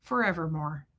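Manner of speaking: lightly imitated low, soft voice; Fred's catchphrase. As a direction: pitch it down and let it fall softly. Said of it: "He'd go".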